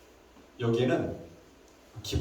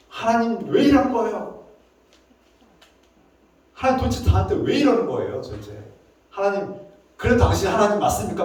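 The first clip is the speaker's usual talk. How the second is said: high-pitched, exasperated complaint; son confronting father; 5 semitones higher than usual. Acted. Son comes to his father to complain, insolent and exasperated. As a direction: protest like an aggrieved son demanding answers. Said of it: "마치 아들이 아버지한테 와서".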